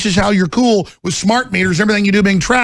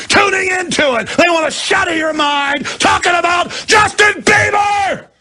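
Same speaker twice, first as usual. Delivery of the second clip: impassioned shouting; 7 semitones higher than usual. Real, not acted. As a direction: shout it like a fiery sermon. Passionate rant to kids.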